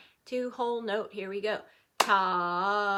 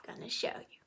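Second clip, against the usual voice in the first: whisper; 10 dB quieter than usual. Real, not acted.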